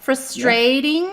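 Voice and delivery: slow frus-TRATE-ing for "frustrating"; British pronunciation. Shown as a demonstration. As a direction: draw out the second syllable of "frustrating". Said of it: "those are my top favorite words".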